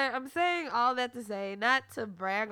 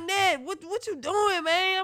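put-on groggy boyfriend; 9 semitones higher, 8 dB louder than usual. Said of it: "He was like".

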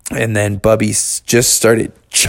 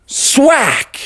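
axe-strike sound effect. Acted. Storyteller acts out father's axe chop, high sudden swack. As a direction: shout the axe hitting wood.

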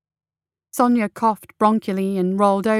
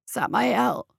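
croaked; 5 dB quieter than usual. A narrator's reading. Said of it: "she croaked".

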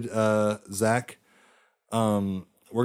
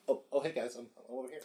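distant, much softer voice off-mic; far from mic; 14 dB quieter, much faster than usual. Retold or imitated. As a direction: call from across the room, away from the mic. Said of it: "Bob, you sound like you're far away".

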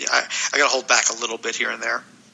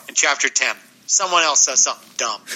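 usual speaking voice

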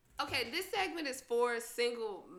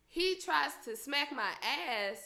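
normal talking voice

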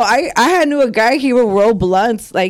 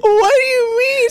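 high, shouted outrage; high outraged cry; 13 semitones higher than usual. Retold or imitated. Answering her ex who called her manly, high and outraged.

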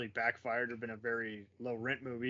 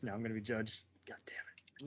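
hushed mock self-reproach; dismayed whisper; 7 dB quieter than usual. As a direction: whisper it to yourself, dreading judgment. Acted private confession of guilty liking.

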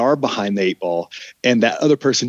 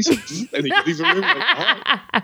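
high laughing squeak. Real, not acted. Telling Kate how she left the room, laughing high. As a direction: laugh through the words, very high.

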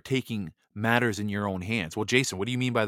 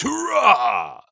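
hammed-up high, loud battle cry; mock cheer; 10 semitones higher, 6 dB louder than usual. Mocking a gun-happy chat member, hammed-up high, loud cheer. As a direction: cheer it like a cartoon gun nut rallying the troops.

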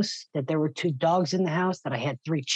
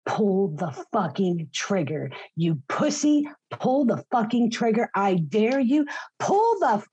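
retold screaming; 5 semitones higher than usual. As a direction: scream it at him, daring him to shoot. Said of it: "I'm screaming back at him".

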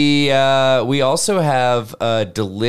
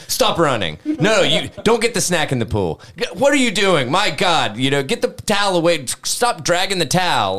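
mock exasperated scolding, raised pitch; retold parental yelling; 6 semitones higher than usual. Dad retelling scolding his child at the pool, mock yelling. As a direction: imitate a fed-up parent yelling. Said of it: "I've been yelling at my child".

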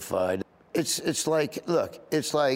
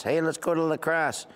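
mild Upper Midwest twang on La Crosse; cousins' accent; 5 semitones higher than usual. Retold or imitated. Imitating how his cousins talk about La Crosse, mild Midwest accent. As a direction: lightly mimic the cousins' Midwestern vowels. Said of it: "all my cousins talk like that".

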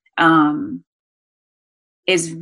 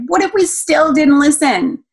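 higher, louder coaxing question; raised questioning; 9 semitones higher, 4 dB louder than usual. Retold or imitated. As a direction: press the child gently, voice up. Mother questioning daughter, heightened.

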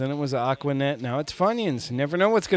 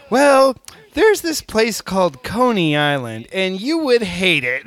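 loud emphatic; 5 semitones higher, 8 dB louder than usual. Retold or imitated. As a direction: declare it loudly. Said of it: "I was like".

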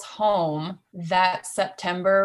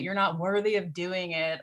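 usual speaking voice

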